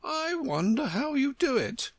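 high, superior flower voice; prim Rose. Acted. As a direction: talk down to her politely. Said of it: "said the Rose".

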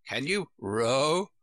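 slow sheep character voice. A narrator's reading. Sheep asks Alice if she can row, slow character voice. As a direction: draw it out as the sheep.

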